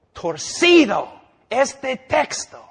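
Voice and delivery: stretched high on torcido este texto; emphatic slow. Real, not acted. Preacher stresses twisted text. (torcido este texto, lento y agudo)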